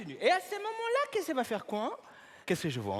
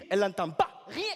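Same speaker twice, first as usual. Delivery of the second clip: ordinary talk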